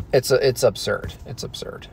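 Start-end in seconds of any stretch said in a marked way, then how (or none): 1.3-1.9 voiceless whisper; whispered aside. Acted. Mocking the moon landing, whispered. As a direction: breathe it out as a secret aside.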